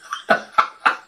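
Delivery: short breathy giggle; quick titter between lines; amused chuckle. Real, not acted. Vlogger amused by the bickering, short giggle.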